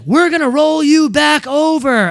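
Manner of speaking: high, loud, slow announcement; imitating shouting staffer. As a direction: call it out slowly to someone trapped. Imitating staffer calling to overturned driver.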